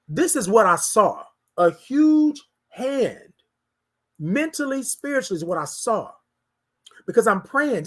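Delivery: ordinary talk, nothing marked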